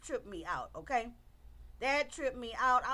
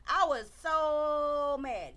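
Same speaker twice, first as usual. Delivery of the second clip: "I was so mad" drawn out slowly, every word weighted; slow right down and lean on each word of "I was so mad"; slow emphatic repeat; 4 dB louder, much slower than usual. Real, not acted.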